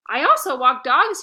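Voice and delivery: put-on young hustler pitching, loud; eager sales pitch. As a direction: sell yourself to the customer.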